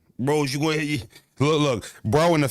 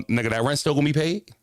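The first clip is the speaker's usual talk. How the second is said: usual speaking voice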